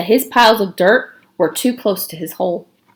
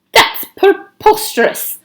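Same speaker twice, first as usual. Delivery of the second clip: higher, slow scandalized outburst; indignant exclamation; 12 semitones higher, slower than usual. A narrator's reading. Igor scoffs at letting Myra choose, high and outraged. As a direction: spit the word out in outrage. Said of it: "exclaimed Igor".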